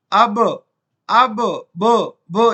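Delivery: अब with a short bouncing echo after the ब; echoed qalqalah. Shown as a demonstration. Teacher showing students the doubled sound, echoed qalqalah on अब. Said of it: "आवाज double आ रही है, इसको बोलते हैं कि आवाज को हरकत".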